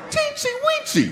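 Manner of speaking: high, squeaky cutesy. Acted. Comedian listing tiny words, squeaking the last. Silly word list climax.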